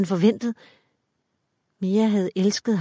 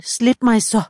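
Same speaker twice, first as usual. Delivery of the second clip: higher, louder gasped plea; gasping; 4 semitones higher, 6 dB louder than usual. A narrator's reading. Mia struggling under the man's hand, gasping louder. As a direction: push the gasped demand up and out. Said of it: "gispede hun".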